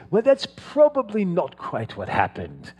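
a gentle hint of a proper British accent. Retelling a British history teacher's dry correction, light British accent. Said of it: "and just very proper British accent".

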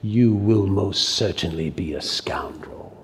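slow, hushed whisper; ominous whisper. Acted. Lecturer warning young people of their fate, slow ominous whisper. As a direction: whisper the verdict slowly and deliberately. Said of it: "he says it like this".

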